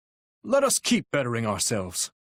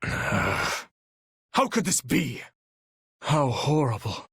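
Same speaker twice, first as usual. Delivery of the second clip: dismayed sigh; much slower than usual. Acted. Dismayed character voice line.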